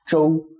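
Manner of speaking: "zhou" high and level; "zhou" in a steady Mandarin tone one; first tone. Shown as a demonstration. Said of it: "first tone".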